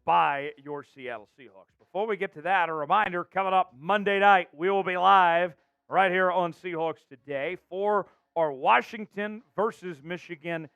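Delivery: energetic, emphatic sports host delivery; announcer show open. Acted. Host welcomes viewers to Seahawks show, energetic announcer. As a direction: punch key words like a show host.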